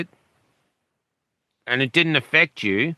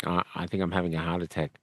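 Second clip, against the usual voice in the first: weak faint voice; 7 semitones lower, 10 dB quieter, faster than usual. Retold or imitated. Fading patient asking for help.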